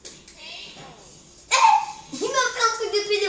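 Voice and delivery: high indignant outburst; raging gamer. Real, not acted. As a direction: yell in outrage. Outraged at opponent's move.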